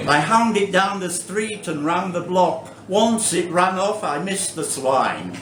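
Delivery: deadpan verse recital with room for laughs; comic poetry reading. Acted. Poet confiding a pet hate to a laughing audience, comic recital. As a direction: read the poem in measured phrases, building then dropping for the punchline. Reads comic poem aloud.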